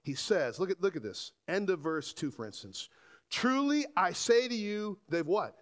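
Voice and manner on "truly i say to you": high, emphatic scripture quote; emphatic quoting of Jesus. Retold or imitated. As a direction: proclaim it firmly, pitched well up. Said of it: "He's quite emphamat-, emphatic".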